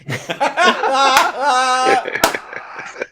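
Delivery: loud, high burst of laughter; real delighted belly laugh; big laugh. Real, not acted. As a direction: crack up loudly and at length. Cracks up at Cockney impression.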